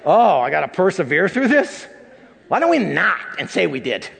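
hammed-up laughing whine; mock whiny reluctance. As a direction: ham up someone wriggling out of hard work. Mock reluctant person dodging therapy.

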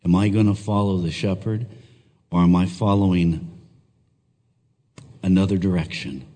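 earnest congregational prayer; leading prayer. Acted. Pastor praying over congregation before sermon, earnest prayer. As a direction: pray aloud for the church, heartfelt.

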